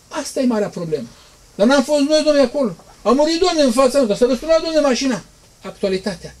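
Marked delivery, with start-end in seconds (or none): 1.6-5.2 much higher, louder excited gossip; hammed-up eager witness; mock eyewitness. Preacher mimics people trusting what they saw on TV, high excited mock. Imitating people boasting about TV news.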